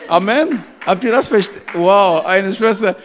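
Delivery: excited preaching shout. Real, not acted. Preacher rousing the congregation.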